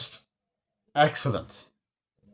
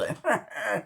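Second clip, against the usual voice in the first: short high, softer cackle; imitated character laugh; 9 semitones higher, 5 dB quieter than usual. Fan showing that kind of laugh, soft imitation. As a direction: mimic his laugh briefly, high and light. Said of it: "he does that kind of laugh".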